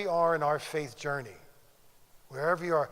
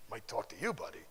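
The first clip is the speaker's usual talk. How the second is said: under-the-breath scoffing whisper; whispered aside; 6 semitones lower, 8 dB quieter than usual. Retold or imitated. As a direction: whisper it as a private sneer at the speaker. Muttered private thought in church.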